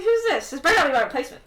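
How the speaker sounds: high, loud start dropping into banter; joking accusation. Acted. Merlin to Cain about the newcomer, joking mock jealousy. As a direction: tease with pretend jealousy. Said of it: "As you can tell, he's joking".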